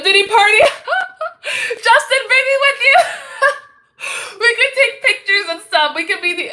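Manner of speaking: high, loud words broken by laughter; real laughter spilling through the words; laughing hard. Real, not acted. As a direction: crack up while getting the words out. Cracking up at a joke.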